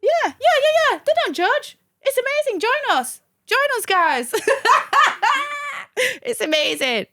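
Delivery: squealing, jokey "join us, guys"; mock gushing recruiter. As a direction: sell the church like an overexcited convert. Mock recruiting pitch for her church.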